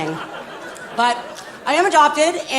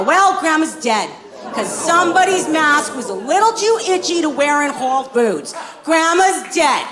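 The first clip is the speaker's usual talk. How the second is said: rubbing it in their faces; sarcastic stepdad; 6 semitones higher than usual. Acted. Stepdad scolding kids.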